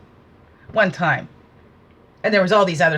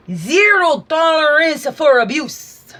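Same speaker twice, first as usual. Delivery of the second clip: slow, louder and higher; emphatic declaration; 7 semitones higher, 6 dB louder, slower than usual. Real, not acted. Speaker declaring a stand against abuse, slow and loud. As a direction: hammer each word as a firm rule.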